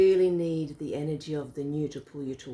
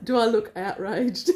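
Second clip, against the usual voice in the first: laughing; 5 semitones higher, 6 dB louder than usual. Real, not acted. Laughing at the question.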